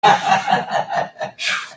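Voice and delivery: winded, breathless laughter; out of breath. Real, not acted. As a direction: talk while short of breath. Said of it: "I'm out of breath".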